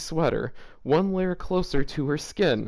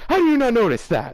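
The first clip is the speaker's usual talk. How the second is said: incredulous; 7 semitones higher, 6 dB louder than usual. Acted. Reading an indignant line aloud.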